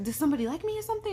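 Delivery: high, fast, incredulous question; eager questioning. Retold or imitated. Friend guessing someone likes him, high eager questioning.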